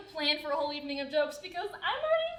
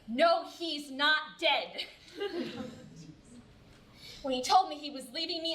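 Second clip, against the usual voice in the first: ordinary talk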